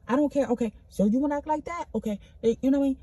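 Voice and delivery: high, loud defiant retort; mock defiant snap. Acting out a petty argument, loud and high. Acted quarrel, defiant replies.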